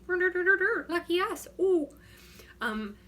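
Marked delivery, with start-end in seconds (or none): 0.1-1.9 high, loud, drawn-out mock Minnesota cheer; Minnesota accent. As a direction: crow it slowly in a put-on Minnesota accent. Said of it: "talking like I'm from Minnesota".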